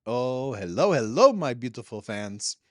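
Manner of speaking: loud, hammed-up welcome to the fans; grand greeting. Host hamming up a hello to fans.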